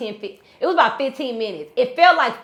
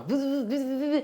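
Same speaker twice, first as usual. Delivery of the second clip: phone vibration noise; 2 semitones higher, 7 dB quieter than usual. Retold or imitated. Retelling being woken by her phone, imitated vibrating buzz. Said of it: "my phone is going".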